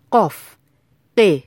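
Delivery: قاف with a firm, struck q at the start; hard-attack qaf. Shown as a demonstration. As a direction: hit the ق as a hard stop. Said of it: "حرف قاف با اتک و ضربه".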